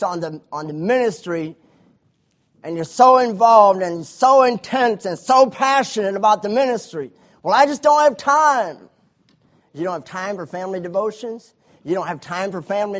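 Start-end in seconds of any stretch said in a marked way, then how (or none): 2.6-7.1 louder, higher rising sermon; preaching at full force. Acted. Preacher warning ministers with rising loud fervour. 7.4-8.9 high, loud whiny excuse; mock busy minister. Preacher mocking a minister's excuse to the church.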